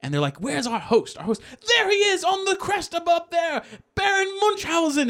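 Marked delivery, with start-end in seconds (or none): 0.4-1.4 lightly voiced, higher-pitched storybook character; theatrical searching. Acting famous people seeking their host. 1.7-5.1 high theatrical herald; grand announcement. Retold or imitated. Acting guests announcing the Baron's arrival.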